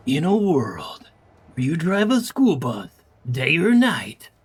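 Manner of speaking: slow, dramatic trailer voice; epic movie trailer narrator. Shown as a demonstration. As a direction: announce it like a blockbuster trailer. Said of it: "you go real low and then you go".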